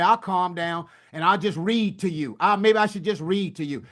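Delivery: usual speaking voice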